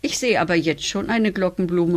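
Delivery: ordinary delivery, nothing marked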